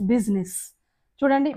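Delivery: usual speaking voice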